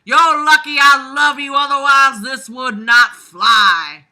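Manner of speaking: mom calling back. Retold or imitated.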